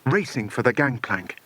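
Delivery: ordinary delivery, nothing marked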